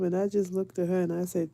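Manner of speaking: ordinary talk, nothing marked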